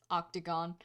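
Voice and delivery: low, hushed single word; very quietly. Acted. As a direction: say it quietly and smugly. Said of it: "very quietly says".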